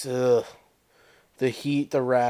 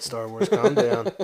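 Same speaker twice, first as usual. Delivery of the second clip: laughing; 6 semitones higher, slower than usual. Real, not acted. Laughing at friend's joke.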